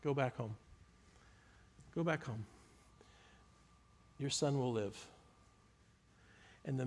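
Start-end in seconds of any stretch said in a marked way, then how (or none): 0.0-5.1 calm reassurance. Retold or imitated. Jesus reassuring the father, soft and calm. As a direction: tell him quietly it's done, no need to worry. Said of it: "Jesus says to him this".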